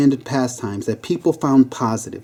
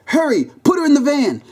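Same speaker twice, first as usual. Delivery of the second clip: high-pitched urgent order; insistent, strained command; 14 semitones higher than usual. Acted. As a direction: urge the team on, voice way up. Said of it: "with a strained look on his face".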